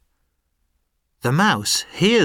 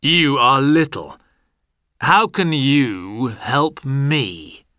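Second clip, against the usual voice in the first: scoffing lion; 4 dB louder than usual. Acted. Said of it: "it says".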